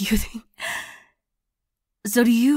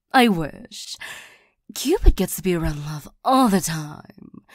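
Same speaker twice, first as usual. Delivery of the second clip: ordinary talk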